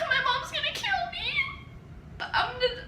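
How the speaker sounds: very high panicked squeak; hammed-up whimpering dread; mock panic. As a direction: squeal it thinly like a kid in trouble. Retold panic, close to crying.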